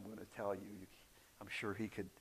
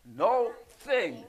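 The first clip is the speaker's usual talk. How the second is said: "No thing" as two separate words, both punched; emphatic split word; 8 semitones higher, 7 dB louder than usual. Shown as a demonstration. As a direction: hit "No" and "thing" apart, louder and higher. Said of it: "you know what that means?".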